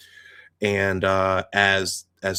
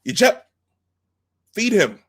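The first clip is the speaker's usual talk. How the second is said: high, loud barked command; Klingon commander order; 12 semitones higher, 6 dB louder than usual. Retold or imitated. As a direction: snap the order at the gunner. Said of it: "then he says".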